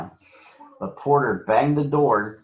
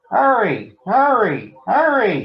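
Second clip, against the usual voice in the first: high, loud puffing hurry; puffing like Gordon; 12 semitones higher, 5 dB louder than usual. A narrator's reading. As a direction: puff it out like a big engine. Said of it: "he puffed, pretending to be like Gordon".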